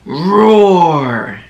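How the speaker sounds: growly mock tiger roar; a playful, lightly growled roar. Shown as a demonstration. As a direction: roar like a friendly tiger for kids. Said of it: "Tigers say".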